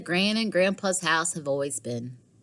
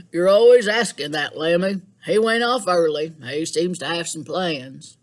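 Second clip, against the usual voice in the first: put-on louder, hearty old man; Grandpa voice; 7 dB louder than usual. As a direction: answer like a jolly grandpa. Grandpa voiced answering Lily.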